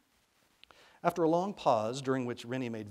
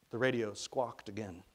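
ordinary delivery, nothing marked

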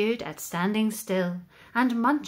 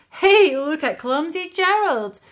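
higher, louder taunting call; mocking jeer; 5 semitones higher, 8 dB louder than usual. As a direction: jeer at him across the floor. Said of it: "The animals all laughed".

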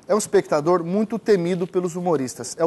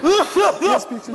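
coughing laugh; 10 semitones higher than usual. Shown as a demonstration. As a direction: joke broken by coughing laughs. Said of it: "É mais ou menos assim".

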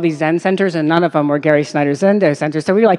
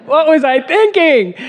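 higher, drawn-out mock dismay; exasperated self-question; 9 semitones higher, 4 dB louder, slower than usual. Traveler regretting a road trip two days in, exasperated. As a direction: wail it lightly at your own folly.

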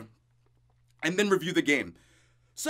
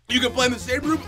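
ordinary delivery

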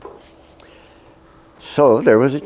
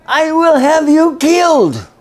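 much higher, slow, screaming threat in broken English; furious shout; 5 dB louder, slower than usual. Retold or imitated. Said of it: "he shouted at me".

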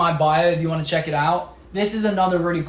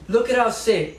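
ordinary delivery

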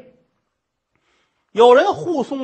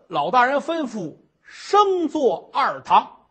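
normal talking voice

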